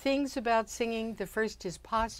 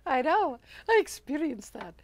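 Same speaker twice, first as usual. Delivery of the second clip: posh lady voice; 6 semitones higher than usual. Acted. Continues posh lady bit.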